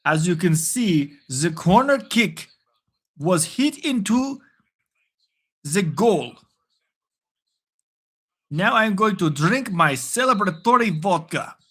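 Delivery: slow, thick mock-Russian accent; fake Russian accent. Joking Russian football channel host calling a goal, thick fake Russian accent. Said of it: "thickest, fakest accent".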